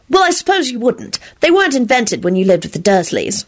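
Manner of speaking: matter-of-fact explainer's voice; practical. A narrator's reading. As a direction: explain it briskly and sensibly. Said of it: "responded in her practical voice".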